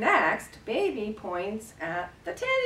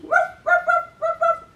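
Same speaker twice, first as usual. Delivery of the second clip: high, loud little yaps; puppy barking; 9 dB louder than usual. Pretend. Carer playing the puppy at the door, yappy barking. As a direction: bark like an excited puppy. Imitates puppy arriving in story.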